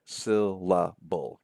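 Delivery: SYLLABLE split slowly into three parts, syl-la-ble. Shown as a demonstration. Lecturer showing students the three parts of syllable, slow and segmented. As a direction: draw out each beat of SYLLABLE separately. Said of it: "You can hear those sort of three parts".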